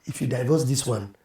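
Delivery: near-whispered sincere counsel; hushed. Real, not acted. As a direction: lean in and say it close and low. Drops into hushed earnest advice.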